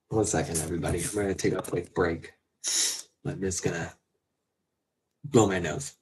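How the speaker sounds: soft, teary break-off; quiet voice after crying; tearful. Real, not acted. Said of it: "I'm crying".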